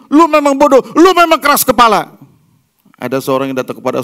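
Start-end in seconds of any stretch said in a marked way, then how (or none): 0.1-2.2 much higher, much louder yelling insult; furious scolding. Retold or imitated. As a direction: parent yells insults at the child in fury. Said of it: "Waktu kita marahin anak kita".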